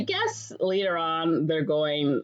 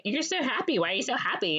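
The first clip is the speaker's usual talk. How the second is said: higher-pitched mock excitement; nosy friend; 6 semitones higher, faster than usual. Friend asks Mary why she is happy, mock excited. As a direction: imitate a friend prying eagerly.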